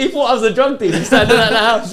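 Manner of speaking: laughing. Real, not acted. Laughing at the mix-up.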